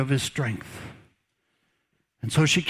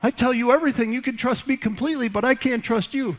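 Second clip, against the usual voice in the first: high, whiny mock-hurt woman's voice; pouty Delilah; 6 semitones higher than usual. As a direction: play the wounded lover, pleading. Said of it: "And she said".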